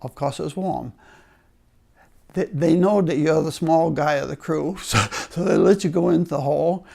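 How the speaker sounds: usual speaking voice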